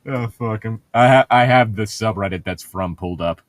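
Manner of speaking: normal talking voice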